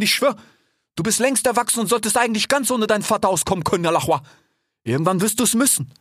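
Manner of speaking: high, anxious fatherly questions; worried father fox. Acted. Fennec anxiously questioning his son, high voiced. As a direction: fuss over him like a worried dad.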